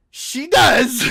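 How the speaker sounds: loud, insistent shout; emphatic shout. Real, not acted. Insisting to viewers, loud emphatic shout. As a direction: shout it to settle the argument.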